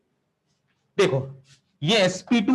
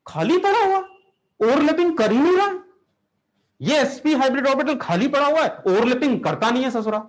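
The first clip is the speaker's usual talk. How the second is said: mock outrage; 7 semitones higher than usual. Teacher mock outrage at orbital.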